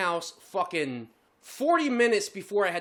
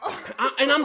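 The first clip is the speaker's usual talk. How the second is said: ordinary talk, nothing marked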